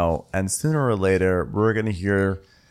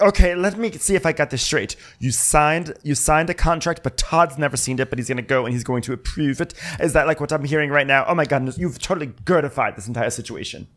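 mock Gertie; 7 semitones higher than usual. Gertie grilling Alexia about the contract, high and sassy. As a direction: play her as a know-it-all interrogator. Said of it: "and Gertie's like".

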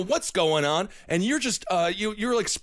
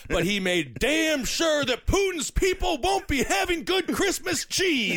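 high, slow mock-outraged shout; mock outrage; 10 semitones higher, slower than usual. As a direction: rant it like fake patriotic fury. Mock outrage about Putin's Christmas.

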